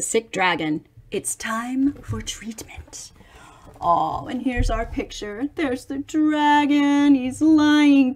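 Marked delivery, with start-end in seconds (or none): 1.1-3.1 hushed whisper. Acted. Reading to children, whispering it like a secret. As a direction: lean in and breathe it secretly.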